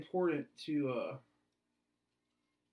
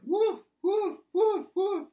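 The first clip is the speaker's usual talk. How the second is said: dog barking; 8 dB louder, much slower than usual. Retold or imitated. The dog answers.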